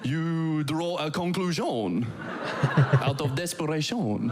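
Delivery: slow, laughing French-accented words. Shown as a demonstration. Comedian mocking Danish to a laughing crowd, slow French accent. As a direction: drawl the endings like French. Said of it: "in a French accent".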